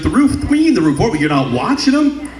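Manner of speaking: high-pitched, indignant retold yell; mock yelling mom. Mom yelling at dad about the roof, mock outrage. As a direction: imitate an outraged mother, pitch jumping up. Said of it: "She starts yelling at me".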